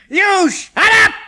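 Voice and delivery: loud, high, slow angry snap; snapped. A narrator's reading. Chemist snapping at Uncle Roger, loud and high. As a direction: bite his head off. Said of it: "the chemist snapped".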